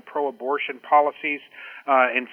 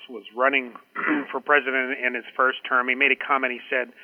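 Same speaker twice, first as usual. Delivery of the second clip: ordinary talk, nothing marked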